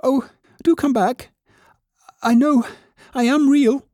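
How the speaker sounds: very high-pitched, pleading voice; little rabbit calling. A narrator's reading. Toy rabbit begging rabbits to return, high calling plea. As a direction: plead after them in a small, high voice. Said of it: "called the little rabbit".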